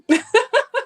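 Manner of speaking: loud high laugh. Real, not acted. Laughing at own story.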